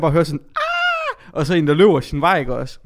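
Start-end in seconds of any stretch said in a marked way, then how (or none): 0.6-1.3 high scream. Retold or imitated. Scream from toilet in story.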